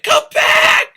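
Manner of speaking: breathy aside then high loud shout; Rose's cry. Retold or imitated. Rose turning from Jack to the boat, high loud shout. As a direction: shriek after the boat.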